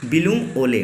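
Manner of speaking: usual speaking voice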